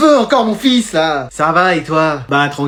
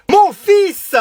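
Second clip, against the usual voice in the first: mock award reveal; 11 semitones higher, 5 dB louder than usual.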